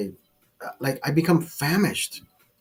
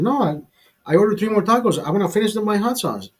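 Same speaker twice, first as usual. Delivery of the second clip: pitched up high, eager and insistent; eager protest; 7 semitones higher, faster than usual. Retold or imitated. Telling the restaurant owner he wants more hot sauce, eagerly pitched up. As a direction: insist you loved it, voice jumping up.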